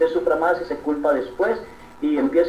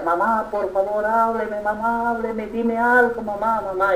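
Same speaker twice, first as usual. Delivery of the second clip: higher, wailing plea to a dead mother; sobbing daughter's higher-pitched plea; pleading daughter crying; 7 semitones higher than usual. Retold or imitated. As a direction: cry out to mom, begging her to speak. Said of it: "y lloraba y clamaba".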